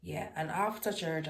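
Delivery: ordinary talk, nothing marked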